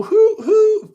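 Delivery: hoo hoo high and loud, full whistle; proper whistle hoo. Shown as a demonstration. Contrasting the right whistle for kids, loud hoo hoo. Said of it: "I only go doo, doo".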